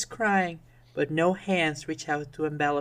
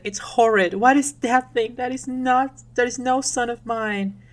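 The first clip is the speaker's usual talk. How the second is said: acted revulsion, pitched up; horrified queen; 6 semitones higher, 3 dB louder than usual. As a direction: disown the child with theatrical disgust. Queen rejects her monstrous baby.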